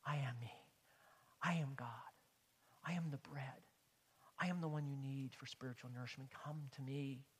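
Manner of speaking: soft, low, whispered words of Jesus; preacher softly voicing Jesus to the congregation. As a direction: hush it like a secret revealed. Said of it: "He's telling them again".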